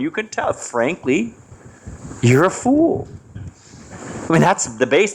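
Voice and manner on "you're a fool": higher, blunt put-down; blunt insult. Shown as a demonstration. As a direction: say it flat out as a jab. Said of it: "you don't say it like that".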